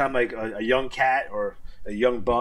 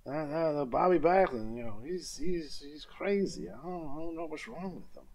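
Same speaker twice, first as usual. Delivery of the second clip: put-on mumbling old wrestler voice, trailing off soft; lightly imitating a rambling old man; Terry Funk impression; 5 semitones higher, 3 dB quieter than usual. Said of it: "he's like".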